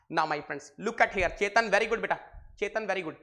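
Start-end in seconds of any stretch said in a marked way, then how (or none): none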